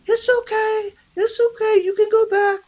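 put-on very high, light soothing baby talk; imitated high-pitched baby voice meant to soothe. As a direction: coo to it like you would to a dog, way up high. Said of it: "really light and baby tone".

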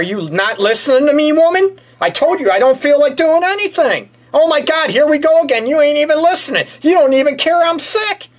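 high, exasperated complaining husband; whiny sick husband. Pretend. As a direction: whine at her like a put-upon sick husband. Role-played sick husband complaining.

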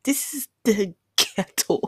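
half-whispered, then a disgusted, hammed-up scoff; exasperated disbelief. Newly arrived abroad, mocking her own shock at the place, in exasperated disbelief. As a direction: scoff at it like you can't believe where you've landed. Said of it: "'Cause I was like".

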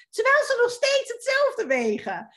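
high, amazed exclamation. Real, not acted. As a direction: say it with real wonder. Amazed point about unchanged weight. (verbaasd uitroepen)

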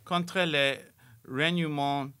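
normal talking voice

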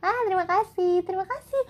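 several high cheering voices; many voices in unison. Acted. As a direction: shout thanks as a happy crowd. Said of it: "berbagai suara yang berteriak bersama-sama".

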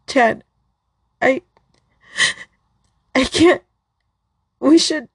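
high, tearful voice, breaking down; sobbing as she gives in; crying. Acted. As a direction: choke out the words through tears. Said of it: "I broke down crying".

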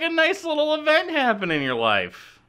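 high, sing-song dreamy voice; mock romantic. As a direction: play it like a swooning romantic.

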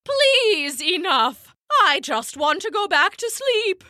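pleading owl. Acted. Owl pleading after shout.

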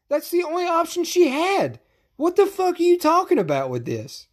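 high, pissed-off ranting at Dana; angry rant. Real, not acted. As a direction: demand an answer, exasperated. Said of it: "'cause I'm getting pissed".

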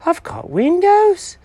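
very high, thrilled cat voice; cat marvelling at the finished house, very high; amazed delight. Acted. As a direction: gush over the new room.

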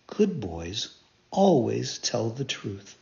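patient fairy. Acted. Fairy teaching the puppet, slow and low. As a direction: teach it slowly and kindly.

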